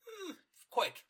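very high, put-on snobby murmur; snooty aristocrat. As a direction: sniff it like a haughty socialite. Co-host voices snooty aristocrat.

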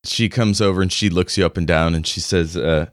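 ordinary talk, nothing marked

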